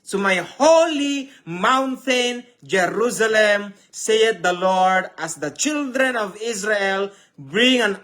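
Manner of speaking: fervent scripture reading; preaching at full force. Acted. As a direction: declaim each phrase to the crowd. Long verse read preaching style.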